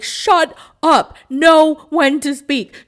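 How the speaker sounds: slow, loud, hammered-out words; exasperated scolding. Acted. Scolding the tactless woman she discusses.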